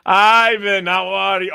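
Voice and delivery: high, loud, over-the-top hello; loud mock greeting. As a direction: hail him like a long-lost comrade. Playful mock greeting to friend.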